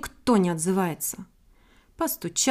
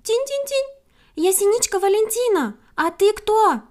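high, bright chirping bird voice; 10 semitones higher, 4 dB louder than usual. A narrator's reading. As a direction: twitter it like a titmouse. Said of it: "и защебетала".

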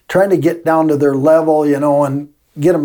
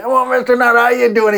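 put-on much higher, bright hello to an old teacher; cheerful greeting; 9 semitones higher than usual. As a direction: call out happily like a grown kid spotting his teacher. Former student greets old teacher.

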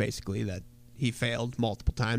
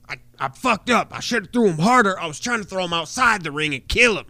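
crazed wrestler interview; 5 semitones higher than usual. Retold or imitated. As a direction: play him as unhinged and proud. New Jack's crazed interview quote.

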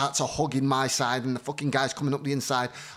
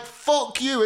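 much higher snapped insult; mock snap; 9 semitones higher than usual. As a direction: fire it back at him instantly. Imagined angry retort.

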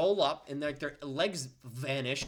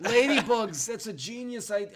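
higher, hammed-up praise of the idea; mock excited boss; 5 semitones higher, 5 dB louder than usual. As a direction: act thrilled at a silly plan. Acting the excited executive.

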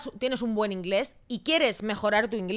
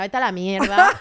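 sudden loud brush-off; blunt retort; 8 dB louder, slower than usual. Retold or imitated. Girlfriend telling boyfriend off, loud blunt brush-off. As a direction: fling it back at him, done with him.

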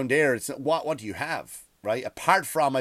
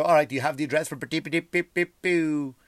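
fast, louder, higher mocking echo; 6 semitones higher, 5 dB louder than usual. Comedian mockingly repeating the clerk's recommendation. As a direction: parrot the name back. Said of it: "And I go".

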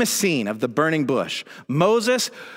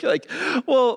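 laughing; 7 semitones higher than usual. Real, not acted. Laughs at the Greek stutter.

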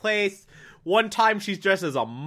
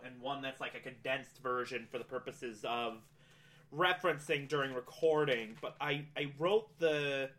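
quiet aside; 5 semitones lower, 15 dB quieter than usual. Real, not acted. Aside about notes, much quieter.

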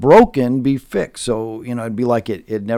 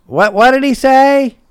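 put-on high, loud old lady asking; a deaf old woman calling out, high-pitched; elderly wife shouting her question; hard-of-hearing wife; 8 dB louder, slower than usual. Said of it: "And his wife goes".

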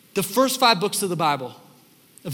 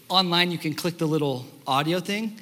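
usual speaking voice

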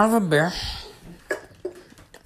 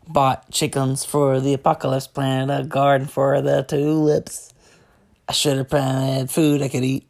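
normal talking voice

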